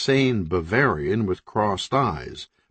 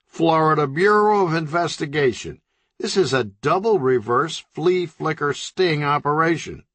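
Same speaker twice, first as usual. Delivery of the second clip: official cop voice; 7 semitones higher than usual. A narrator's reading. Agent shows badge.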